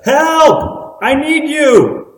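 loud, high-pitched cry for help, drawn out; cry of desperation. Acted. Preacher acting out a prayer for help, desperate cry. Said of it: "cry, a desper-, of desperation".

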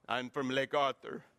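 mock demon. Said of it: "Or if you went to Lake Arthur".

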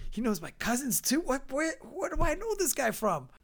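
high, incredulous inner questioning; acted bafflement. Acted bafflement about stranger.

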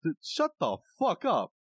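high-pitched, exaggerated outburst; comic fed-up retort, voice leaping high; mock exasperation. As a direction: climb into a hammed-up pleading protest. Acted exasperated retort to the guru.

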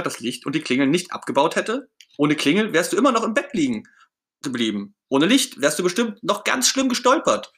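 high, emphatic character voice; Ernie, arguing back. Acted. Ernie listing for Bert what would have gone wrong, insistent. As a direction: argue each point with rising insistence.

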